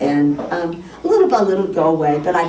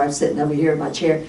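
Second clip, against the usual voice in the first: normal talking voice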